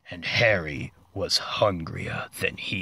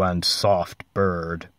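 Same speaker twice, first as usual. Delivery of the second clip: ordinary delivery, nothing marked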